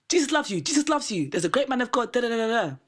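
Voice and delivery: high, preachy sing-song blessing; hammed-up street preacher blessing every car.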